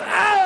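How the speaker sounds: high held cry of labor pain; mock pain scream. Comic imitating his wife having contractions, high mock scream. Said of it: "my wife started having contractions, which I'm told hurt".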